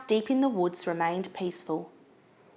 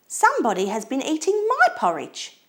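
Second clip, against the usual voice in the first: higher, sweetly exclaimed mother bear voice; sweet Mama Bear; 6 semitones higher, 3 dB louder than usual. A narrator's reading. Mama Bear looks in her bowl, sweet exclaiming. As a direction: exclaim it sweetly, lifted in pitch. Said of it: "in her sweet low voice".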